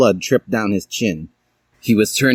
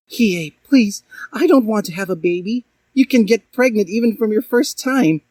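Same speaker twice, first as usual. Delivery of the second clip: much higher, fast pleading; pleading Dally; 12 semitones higher than usual. A narrator's reading. Dally pleading with Hiei, much higher and fast. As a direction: beg him. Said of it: "she said".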